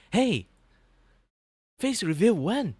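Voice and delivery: put-on high, loud pestering kid; eager kid viewer. Kids watching a VTuber demand a face reveal, loud mock pestering. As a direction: imitate a kid fan demanding loudly. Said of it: "they will be like".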